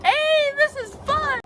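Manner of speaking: loud, very high, drawn-out squeal. Real, not acted. Climber hanging on the fence, squealing that it's fun.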